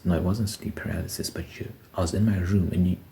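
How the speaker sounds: normal talking voice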